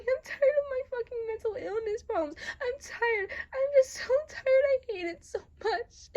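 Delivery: loud, high-pitched tearful outburst; yelling and crying. Acted. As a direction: cry out in pain, voice far up and loud. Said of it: "you start to yell and cry, like, in pain".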